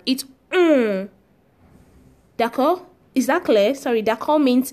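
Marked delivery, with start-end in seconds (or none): 0.5-1.1 "mm" as a held nasal French vowel. Shown as a demonstration. Teacher showing how the Frenchman says O N, held nasal sound. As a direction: draw out "mm" through the nose. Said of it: "For the Frenchman".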